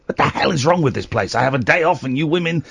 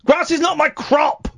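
indignant shout; 12 semitones higher than usual. Acted. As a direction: shout it in outrage.

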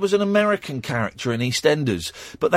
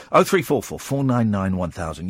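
ordinary talk, nothing marked

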